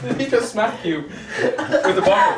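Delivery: laughing. Acted. Drunk partygoer laughing through talk.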